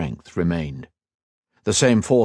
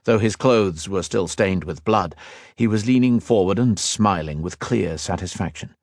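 usual speaking voice

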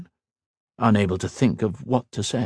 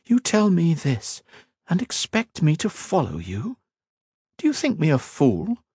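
a hint of a proud queen, raised a little; regal queen, lightly voiced; 9 semitones higher than usual. Queen questioning the mage, lightly voiced regal. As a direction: speak as a wary, commanding queen.

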